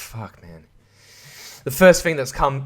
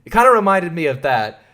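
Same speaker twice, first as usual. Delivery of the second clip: usual speaking voice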